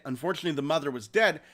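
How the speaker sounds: ordinary talk, nothing marked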